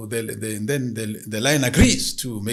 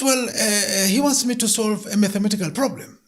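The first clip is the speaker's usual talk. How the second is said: hesitant woman; 10 semitones higher, 6 dB louder than usual. Acted. Woman explains to the spirit.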